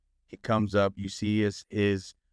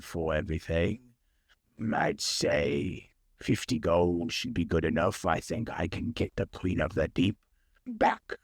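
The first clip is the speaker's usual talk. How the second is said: lanternfish merchant; 3 semitones lower than usual. Acted. Lanternfish haggles over ship repairs.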